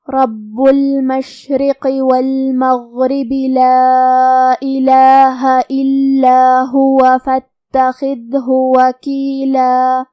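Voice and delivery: slow melodic tajweed chant; Quran recitation. Shown as a demonstration. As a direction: recite the verses slowly and melodically. Recites the verse. (قرآن کی ترتیل)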